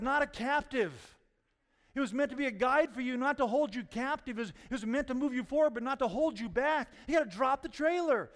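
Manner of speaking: loud, high, driving sermon voice; fervent preacher exhorting the congregation; preaching at full force. Real, not acted. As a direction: preach it hard and steady.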